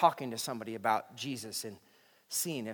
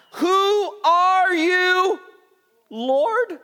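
frightened cry; 16 dB louder, much slower than usual. Acted. Saul cries out in fear.

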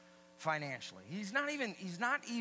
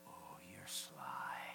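slow, low whisper; mock suspicion; 11 semitones lower, 8 dB quieter, much slower than usual. Congregant catching the preacher out, mock-suspicious whisper.

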